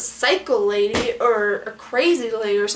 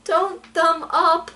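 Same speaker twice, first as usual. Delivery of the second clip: panicked pleading; 7 semitones higher, slower than usual. Retold or imitated. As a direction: beg them to stop, voice shooting up.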